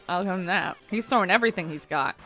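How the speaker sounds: normal talking voice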